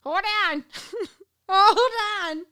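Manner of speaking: high-pitched stoned giggling. Real, not acted. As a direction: squeak it out between giggles. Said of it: "I'm too high".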